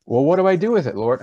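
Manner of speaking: high, loud plea of what do I do; anguished cry to God. Retold or imitated. Preacher reenacting his jail prayer, calling out to God. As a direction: cry out to the Lord in desperation.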